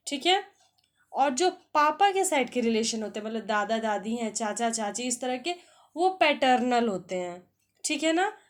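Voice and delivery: ordinary talk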